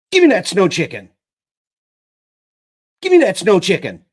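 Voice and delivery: loud, high demanding yell. A narrator's reading. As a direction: shout it, fed up with the dog. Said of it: "Pip yelled".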